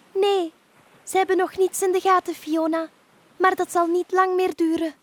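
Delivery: high, loud, quick reply; brisk dolphin. Acted. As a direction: answer her briskly as a dolphin. (vlotte, hoge dolfijnenstem)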